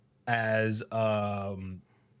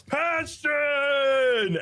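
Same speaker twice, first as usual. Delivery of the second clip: high, held anime attack shout; shouted battle cry; 14 semitones higher, 3 dB louder than usual. Retold or imitated. Quinta shooting a punch at the enemy, shouting. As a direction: belt it out high and long. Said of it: "And he shouts".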